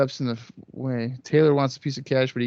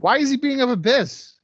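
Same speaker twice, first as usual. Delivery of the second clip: shocked high outburst; 12 semitones higher, 3 dB louder than usual. Real, not acted. Outraged at the attack.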